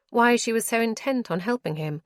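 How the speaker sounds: ordinary delivery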